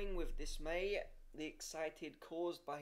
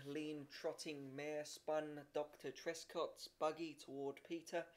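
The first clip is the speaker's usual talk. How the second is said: plain reading voice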